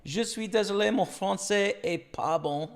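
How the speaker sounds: slow learner's French, English accent; foreign accent in french. Shown as a demonstration. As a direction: exaggerate the beginner accent. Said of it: "You know, stuff like that".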